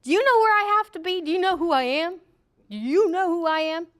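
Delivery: a hint of an irate driver demanding to pass. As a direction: play an entitled motorist scolding the flagger. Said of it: "people who are ticked off".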